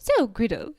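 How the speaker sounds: mock-posh British address to a rival; posh British accent. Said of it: "she's talking in a posh British accent, quite high-pitched".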